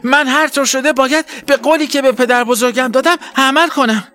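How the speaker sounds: high, resolute self-promise; determined to herself. A narrator's reading. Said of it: "با خودش گفت".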